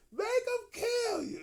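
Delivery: high shouted chant; shrill prison-yard taunt yelled; mock scream. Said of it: "we all start screaming that shit".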